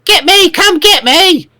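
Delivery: high, shrill goblin taunt; screaming goblin. Acted. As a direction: shriek it out like a goblin. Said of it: "it hears one screaming".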